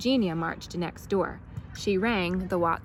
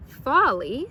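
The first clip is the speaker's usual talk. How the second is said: incredulous; 6 semitones higher, 6 dB louder than usual. A narrator's reading. Watson incredulous.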